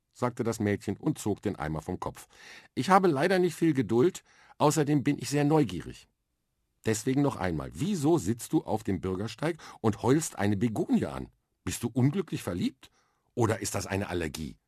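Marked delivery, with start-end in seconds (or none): 2.7-14.6 put-on higher, bright-eyed girl's voice, matter-of-fact; a confident little girl explaining her plan; lively young girl. Girl with a bucket on her head explains space training to Arthur, eager and matter-of-fact. Mia questions Arthur curiously.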